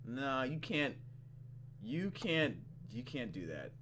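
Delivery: normal talking voice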